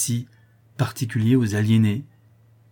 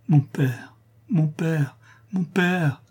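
higher-pitched pleading, climbing on each call; heartrending calls; 7 semitones higher, much slower than usual. A narrator's reading. Daughter's final calls to unresponsive father, heartrending. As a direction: call despairingly for a last answer. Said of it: "À ces appels déchirants".

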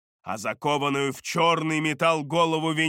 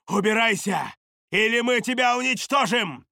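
raised-pitch, forceful warning; commanding threat; 7 semitones higher than usual. A narrator's reading. Sorceress warns the demon to leave, in a firm threat. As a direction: order the monster off.

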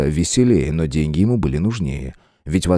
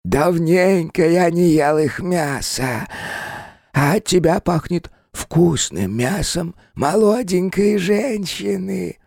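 high, very slow, predatory; menacing spirit voice; 12 semitones higher, slower than usual. Acted. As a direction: drool over every word. Spirit craves human flesh.

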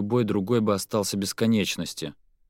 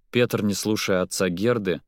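ordinary delivery

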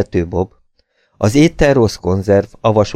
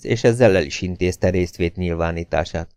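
ordinary delivery

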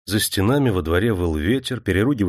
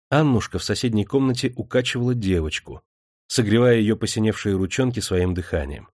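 plain reading voice

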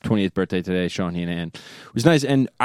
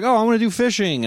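dopey voice; 10 semitones higher than usual. Retold or imitated. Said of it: "I was like".

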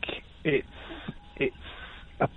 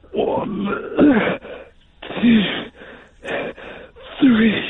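counting while exercising; doing push ups; 5 semitones higher than usual. Real, not acted. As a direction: count through the effort of press-ups. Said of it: "You're not doing them anymore".

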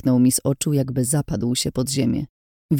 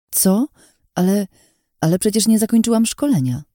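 taken aback, higher voice; stammered, bewildered objection; surprised; 6 semitones higher than usual. A narrator's reading. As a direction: protest in startled disbelief. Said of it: "zdziwiłam się".

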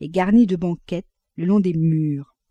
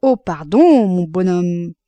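raised, exaggerated fake apology; mock apology; 7 dB louder, slower than usual. A narrator's reading. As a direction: apologise with showy false deference. Mock apology with deep bow. (excuses moqueuses et exagérées)

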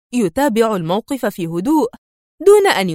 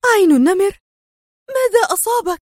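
high-pitched, panicked exclamation; distraught, pitch leaping upward; alarmed cry; 10 semitones higher, 3 dB louder than usual. A narrator's reading. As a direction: cry out in dismay. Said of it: "وهتفت سونيا في جزع".

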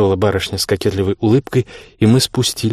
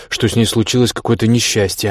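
ordinary delivery, nothing marked

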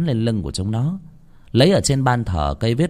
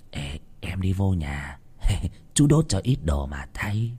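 soft aside; 2 semitones lower, 5 dB quieter than usual. Acted. Uncle quietly tells niece.

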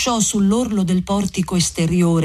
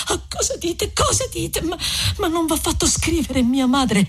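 plaintive and pitched well up; distressed young woman; 10 semitones higher than usual. A narrator's reading. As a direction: protest in a high, anguished voice. Narrator voices distressed Lucia.

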